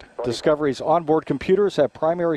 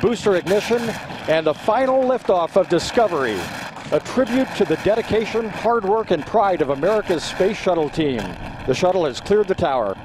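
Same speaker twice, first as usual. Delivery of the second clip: excited announcer; 5 semitones higher than usual. Acted. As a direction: ride the thrill of liftoff, proud. Liftoff call at launch climax.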